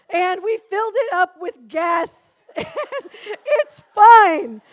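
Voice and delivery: trying to explain but cracking up; laughing. Retold or imitated. Mechanic laughing while explaining.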